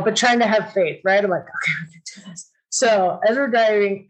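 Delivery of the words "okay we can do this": much softer and very fast, under the breath; quiet self-reassurance. Retold or imitated. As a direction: rush a soft pep talk to yourself. Self-talk to keep calm.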